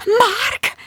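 very high excited cry; thrilled gasp. A narrator's reading. Bridget hearing a man at her door, thrilled. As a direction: squeal the name in hope. Thinks her lover has arrived.

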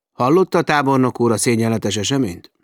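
loud indignant appeal to the general; indignant outburst. Acted. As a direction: storm in with outrage. Rusty confronts the general.

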